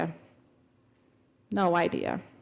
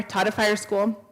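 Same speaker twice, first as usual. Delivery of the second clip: normal talking voice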